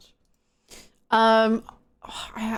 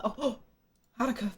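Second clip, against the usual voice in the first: a soft gasp, then Haruka said quietly and touched; gasp of surprise; 2 semitones higher, 7 dB quieter than usual. Real, not acted. Streamer moved by Haruka's gift, soft surprised gasp. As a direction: caught off guard, Haruka breathed out softly. Thanking a viewer for donation.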